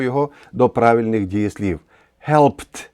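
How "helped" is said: "Helped" as one syllable ending in a crisp T; "Helped" with a silent E and a T sound at the end; correct one-syllable HELPED. Shown as a demonstration. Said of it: "Ми не кажемо helped".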